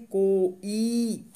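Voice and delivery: को ई said very slowly, the ई held long. Shown as a demonstration. Teacher reading को ई with a long ई. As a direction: stretch the big ई in को ई. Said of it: "आप बड़ी ही पढ़ेंगे".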